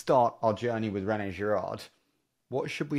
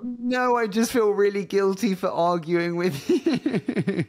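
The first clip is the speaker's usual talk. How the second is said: high, loud, drawn-out words through laughter; helpless real laughter, voice pitched way up; laughing; 9 semitones higher, 6 dB louder, slower than usual. Real, not acted. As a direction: protest while cracking up. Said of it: "I'm just laughing".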